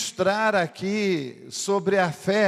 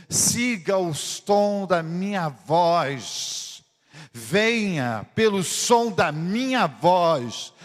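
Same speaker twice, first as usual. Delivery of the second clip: slow, steady fatherly call; calm guiding voice; much slower than usual. Acted. Father slowly guiding his frightened son down the dark stairs. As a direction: lead him gently step by step.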